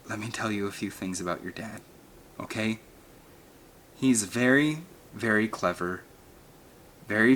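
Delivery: soft, tender mother's voice; calm the boy gently; tender reassurance. A narrator's reading. Mother gently comforts her son.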